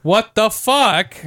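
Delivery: slow, high disbelief; shocked. Real, not acted. Friend hears about stall doors, slow incredulous what the fuck. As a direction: drag out the shock at a pushed-open stall door.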